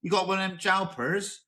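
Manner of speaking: high Bristol accent, mock local. Mocking how Bristol folk say the car's name, in a Bristol accent. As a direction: put on a broad Bristol twang. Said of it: "because in Bristol".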